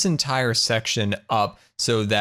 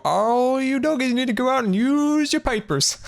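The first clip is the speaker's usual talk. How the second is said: Southern grandma voice. Retold or imitated. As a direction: put on a high-pitched Southern granny accent. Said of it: "why I did a Southern voice".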